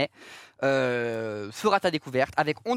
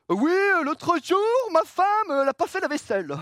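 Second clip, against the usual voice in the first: Olivier de Benoît impression; 10 semitones higher than usual. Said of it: "Olivier de Benoît, il faisait ça".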